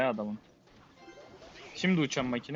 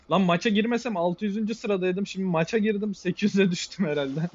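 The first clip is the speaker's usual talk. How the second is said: higher, louder rueful rant; exasperated lament; 5 semitones higher, 5 dB louder than usual. Real, not acted. Player lamenting his rank drop, loud exasperated rant. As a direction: rant at your own bad luck.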